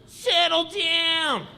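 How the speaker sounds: a powerless cop asking nicely; mock cop. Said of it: "He'd show up at parties like".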